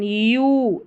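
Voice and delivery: यू stretched out long; long, sustained यू; long vowel. Shown as a demonstration. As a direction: hold the end of यू. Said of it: "लंबा उच्चारण".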